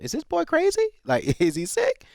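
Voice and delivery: high, loud mock question with a laugh; playing the people who stared at the energetic boy, high and incredulous; mock puzzled onlookers. Imitating puzzled people wondering if he is sick, mock high voice. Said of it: "some people look at me like".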